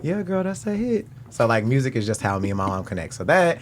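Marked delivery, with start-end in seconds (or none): 0.0-1.1 mom's voice. Retold or imitated. Quoting mom and self.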